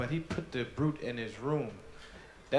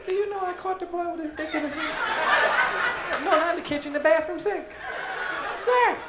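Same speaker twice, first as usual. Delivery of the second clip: very high, fast gossip voice; hammed-up mom spreading the news on the phone; gossiping mother on phone; 4 dB louder than usual. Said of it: "They get on the phone and say".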